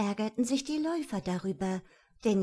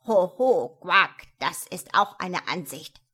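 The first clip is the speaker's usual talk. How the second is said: loud, amused frog voice with a croak; 2 semitones lower, 7 dB louder than usual. A narrator's reading. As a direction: croak it like a fat green frog. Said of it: "quakte es plötzlich neben ihm".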